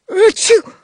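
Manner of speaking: high loud sneeze. A narrator's reading. Guard sneezes loudly.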